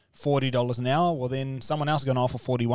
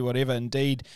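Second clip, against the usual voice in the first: usual speaking voice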